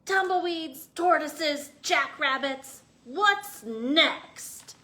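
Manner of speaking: exasperated. Acted. Cactus grumbling about all the visitors. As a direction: throw up your hands at visitors. Hank fed up.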